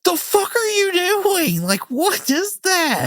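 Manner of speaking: high, slow, baffled disbelief; as if you can't believe what you just watched; incredulous reaction. Retold or imitated. Watching the movie and reacting in high, slow disbelief. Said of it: "And I'm like".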